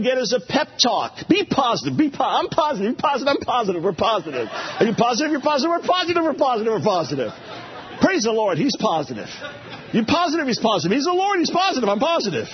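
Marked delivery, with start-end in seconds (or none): 1.3-7.3 chirpy, sing-song parody of positive thinking; mocking an upbeat pep rally; mock pep talk. 8.0-12.5 chirpy, sing-song parody of positive thinking; mocking an upbeat pep rally; mock pep talk. Continuing the parody chant.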